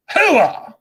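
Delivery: high, loud army battle cry; loud gung-ho soldier. Real, not acted. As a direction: shout it like a soldier rallying troops.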